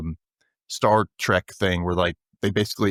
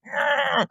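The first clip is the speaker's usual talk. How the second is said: high strained "Ah!" as he tries to teleport; strained teleport grunt. Retold or imitated. Retelling the teleport attempt, a high strained grunt. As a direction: grunt with effort, pitched way up. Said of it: "And he's like".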